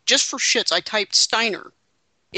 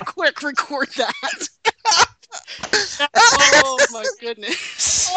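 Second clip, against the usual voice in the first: laughing; 13 semitones higher, 5 dB louder, much slower than usual. Real, not acted. Cracking up at the clip.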